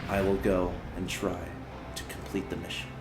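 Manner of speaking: a soldier's last words, barely breathed; brave farewell, quiet. Acted. SCV's brave goodbye to family.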